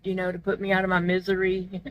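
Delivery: ordinary talk, nothing marked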